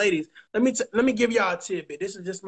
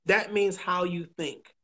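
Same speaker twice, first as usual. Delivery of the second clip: usual speaking voice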